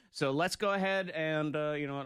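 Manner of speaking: normal talking voice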